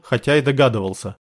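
plain reading voice

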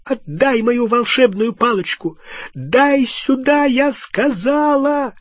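high, screeching, furious order; loud high-pitched raving; frenzied demand. A narrator's reading. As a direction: demand it like a madwoman, voice climbing. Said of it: "Она была прямо как безумная".

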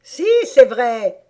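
high, loud, drawn-out Hatter comeback; loud contradicting squabble; insistent retort. Acted. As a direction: insist at the top of your voice. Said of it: "répliqua le chapelier".

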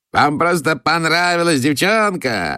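high, slow mocking shout. A narrator's reading. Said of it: "закричал он". (граф насмешливо кричит, высоко и протяжно)

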